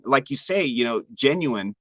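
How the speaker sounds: ordinary talk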